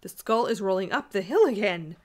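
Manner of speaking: loud cry of alarm; exasperated terror. A narrator's reading. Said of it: "gasping with exasperation and terror".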